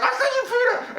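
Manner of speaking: very high, thrilled call; lightly voiced Francine cheering; excited cheer. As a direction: cheer him on from the helicopter. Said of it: "Francine's like".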